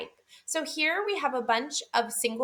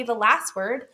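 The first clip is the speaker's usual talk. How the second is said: ordinary talk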